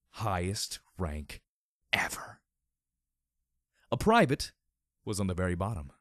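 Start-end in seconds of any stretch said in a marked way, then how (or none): none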